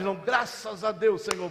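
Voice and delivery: normal talking voice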